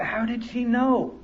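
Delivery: soft, slow, wondering to himself; inner thought. Retold or imitated. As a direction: murmur it as a private realization. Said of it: "I thought".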